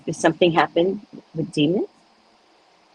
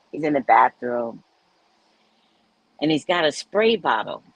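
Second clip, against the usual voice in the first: ordinary delivery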